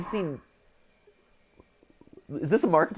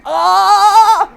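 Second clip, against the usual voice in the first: loud high delighted scream; excited high shriek; 13 dB louder than usual. Real, not acted.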